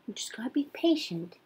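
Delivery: soft soothing whisper. Real, not acted. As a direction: whisper calmly to settle them. Comforting the upset baby.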